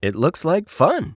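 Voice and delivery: a touch of raccoon eagerness; cheerful raccoon. Acted. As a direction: answer brightly as a keen raccoon.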